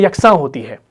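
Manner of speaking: ordinary talk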